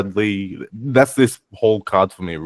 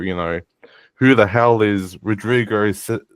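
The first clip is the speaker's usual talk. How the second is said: ordinary delivery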